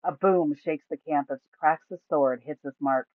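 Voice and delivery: usual speaking voice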